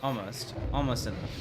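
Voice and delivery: ordinary delivery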